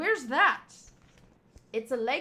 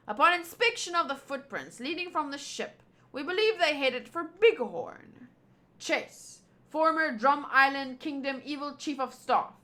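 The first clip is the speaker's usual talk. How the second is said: ordinary talk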